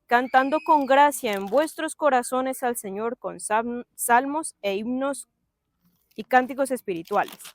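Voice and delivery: loud, solemn reading of scripture. Acted. Preacher reads the verse aloud, solemn and loud. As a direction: proclaim the verse to the church.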